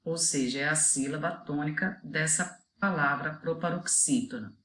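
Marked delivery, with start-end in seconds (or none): none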